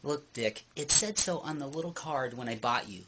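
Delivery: ordinary talk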